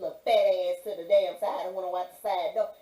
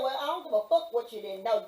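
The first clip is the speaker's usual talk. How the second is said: ordinary talk, nothing marked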